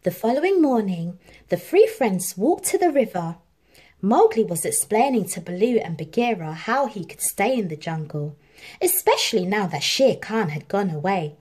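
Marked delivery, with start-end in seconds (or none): none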